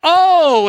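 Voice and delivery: loud, high acted moan; long groaned "oh" of self-pity. Israelites grumbling in the wilderness, moaning. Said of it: "they start to moan a little bit".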